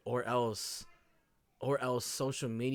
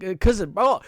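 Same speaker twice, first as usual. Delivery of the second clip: worked up; 9 semitones higher, 13 dB louder than usual. Real, not acted. Emotional, flustered after rant.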